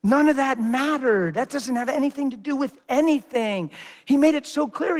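usual speaking voice

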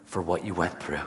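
gently reassuring a hurting waitress; tender and sincere. Retold or imitated. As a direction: comfort her gently.

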